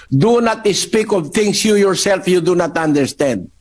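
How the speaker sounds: ordinary talk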